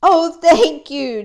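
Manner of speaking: slow, high, loud chirpy thanks; high chirping thank-you; chirped. Acted. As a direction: chirp your thanks brightly. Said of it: "chirped Gertrude McFuzz".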